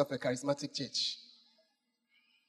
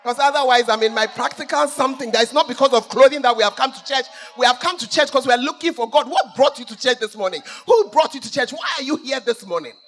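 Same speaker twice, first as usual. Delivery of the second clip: loud, driving sermon voice; preaching at full force; 5 semitones higher, 12 dB louder than usual. Acted. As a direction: hammer it home to the congregation.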